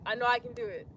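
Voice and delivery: ordinary talk, nothing marked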